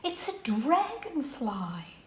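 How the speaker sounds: slow, hushed whisper of discovery; whispered reveal to a child; awed whisper. Acted. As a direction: whisper it slowly in wonder.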